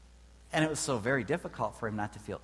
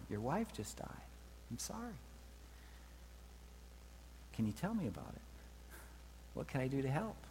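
gentle sympathy; 2 semitones higher, 9 dB quieter than usual. Acted. Voicing compassionate reply to grieving man.

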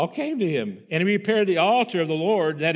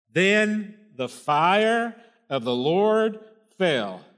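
very slow, drawn out; much slower than usual. Shown as a demonstration. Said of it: "We sung about that today".

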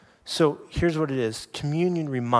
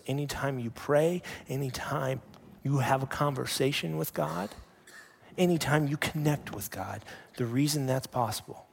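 ordinary talk, nothing marked

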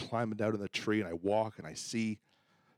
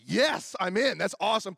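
bright, eager, higher and louder; excited yes; 8 semitones higher, 6 dB louder than usual. Retold or imitated. Friend eagerly accepting the pastor's hunt invite, excited. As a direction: jump at the invitation.

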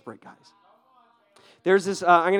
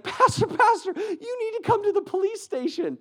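high, urgent calling out; urgent shout; 4 dB louder than usual. Retold or imitated. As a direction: burst in shouting for the pastor. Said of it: "he's like".

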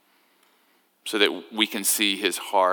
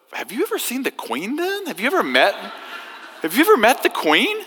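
high, eager tourist voice, much higher pitch; chatty American tourist; 13 semitones higher than usual. Retold or imitated. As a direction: play the clueless tourist, bright and curious. Tourist asking about the Queen.

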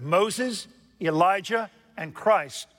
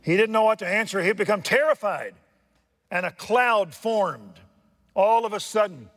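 excited; 6 semitones higher, 4 dB louder than usual. Real, not acted. Preacher builds excitedly.

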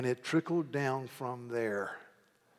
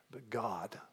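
hushed whisper; 2 semitones lower, 11 dB quieter than usual. Real, not acted. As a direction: whisper the point very softly. Key truth hushed for emphasis.

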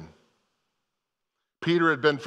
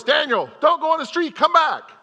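put-on much higher, louder call to a running child; raised voice; 7 dB louder than usual. Grandfather yelling at grandson near the street, loud raised voice. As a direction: call him back off the street, loud and high. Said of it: "So I raise my voice".

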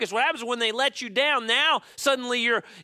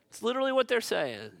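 ordinary talk, nothing marked

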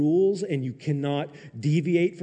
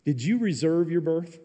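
normal talking voice

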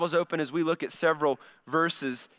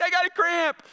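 much higher, pained little complaint; whiny self; 11 semitones higher than usual. Retold or imitated. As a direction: whimper it like a hurt child. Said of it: "And I'm like".

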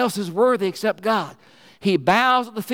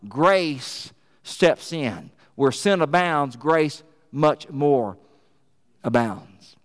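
slow, hushed emphasis; 3 semitones lower, much slower than usual. Acted. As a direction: let grace sink in slowly. Rock's quote slows to a close.

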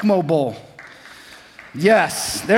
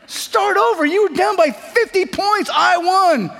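high, loud bickering; angry shouting kids; 14 semitones higher, 4 dB louder, slower than usual. Retold or imitated. Two brothers yelling over who won the game, high and loud. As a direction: argue it out like two furious boys.

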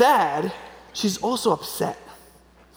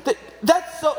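mock outrage; 7 semitones higher than usual. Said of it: "I'm supposed to be like".

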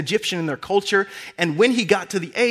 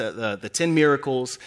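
ordinary delivery

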